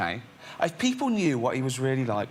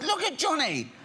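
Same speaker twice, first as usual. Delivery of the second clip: excited call; 12 semitones higher than usual. Retold or imitated. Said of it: "Warwick goes".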